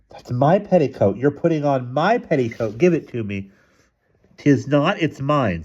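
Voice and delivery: put-on high, possessive child's protest; children squabbling. A narrator's reading. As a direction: argue like a kid claiming what is theirs. Children quarreling over a petticoat.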